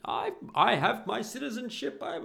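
high, loud mock outburst; hammed-up offended outcry; losing his shit. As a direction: imitate an indignant man protesting at high pitch. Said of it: "he loses his shit".

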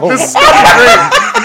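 high, loud, laughing self-quote; laughing mock enthusiasm; retold excitement. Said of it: "I was like".

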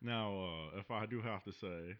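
a hint of a drawling southern colonel; folksy cowboy hero; lightly voiced southern hero talking about whiskey; southern gentleman. Southern character continues.

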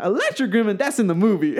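high, showy echo and verdict; imitated flamboyant delight; mock enthusiasm. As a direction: approve a daft idea with theatrical certainty. Script doctor seizes on the idea.

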